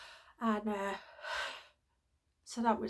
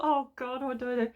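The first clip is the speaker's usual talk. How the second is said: panicked; 2 semitones higher, 5 dB louder than usual. Retold or imitated. Panicked thought at the till.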